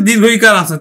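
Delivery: usual speaking voice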